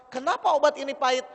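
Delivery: petulant patient griping at the medicine; mock complaint. Mother complaining about bitter medicine.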